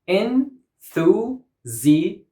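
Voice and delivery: "Enthusiastically" in six separate beats; syllable by syllable. Shown as a demonstration. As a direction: break "Enthusiastically" apart with pauses. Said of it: "six syllables".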